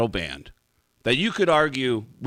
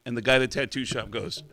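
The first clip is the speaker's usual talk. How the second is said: normal talking voice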